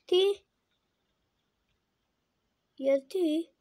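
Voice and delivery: loud, high-pitched questions; alarmed disbelief. Real, not acted. Player questions the game's demand, alarmed outburst. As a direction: burst out in protest.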